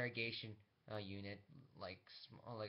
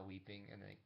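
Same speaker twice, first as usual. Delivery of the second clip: ordinary delivery, nothing marked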